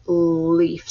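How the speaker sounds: "leaf" with a long held L; stressed first sound. Shown as a demonstration. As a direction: stretch the L that starts "leaf". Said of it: "ul sound like leaf".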